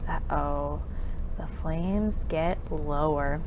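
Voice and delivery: normal talking voice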